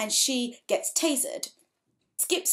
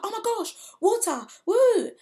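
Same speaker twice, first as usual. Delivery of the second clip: mock delight; 12 semitones higher, 7 dB louder than usual. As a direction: ham up a naive cheer. Tortured victim sees water.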